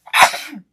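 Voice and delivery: sudden loud sneeze; a real sneeze that bursts out; sneezing. Real, not acted. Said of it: "I'm gonna sneeze".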